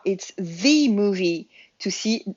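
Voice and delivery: the stretched to thee, slow and leaning on it; slow down and press on the; emphatic thee. Shown as a demonstration. Teacher showing the emphatic the in a slow example. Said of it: "vous allez pouvoir le prononcer comme ça aussi".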